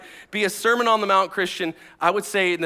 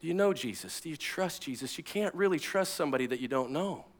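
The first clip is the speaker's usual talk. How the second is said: soft, searching questions; gentle pastoral challenge; hushed, earnest appeal; 3 semitones lower, 9 dB quieter than usual. Real, not acted. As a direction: quietly press the question home. Preacher repeats the searching question softly.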